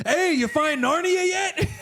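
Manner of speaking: high, loud, slow call down the hole; playful shout down into the hole, slow and high; joking shout. Retold or imitated. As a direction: yell a joke down into the crawlspace. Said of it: "I go down there and I go".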